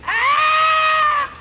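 piercing high shriek; blood-curdling scream. Retold or imitated. Woman finding dead rabbit, piercing scream. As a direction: scream as loud and high as you can. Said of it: "this blood-curdling scream".

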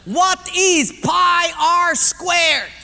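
slow, high, loud, word by word; shouted quiz question. Acted. Personnel guy poses the math question, slow shout. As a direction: boom it out slowly as a challenge.

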